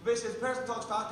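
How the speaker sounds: fast, tumbling words; rapid-fire. Shown as a demonstration. Comic showing the crowd how fast he talks, rapid-fire. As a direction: rattle it off as fast as you can. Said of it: "I have a tendency to talk fast".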